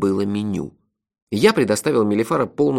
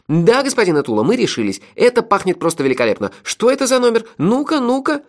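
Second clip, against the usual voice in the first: high, loud, climbing to an impatient peak; eager excitement; 12 semitones higher, 4 dB louder than usual. A narrator's reading. Melifaro demands the number of the fragrant dish, eager excitement. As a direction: press the innkeeper with delighted curiosity. Мелифаро в восторге от запаха блюда.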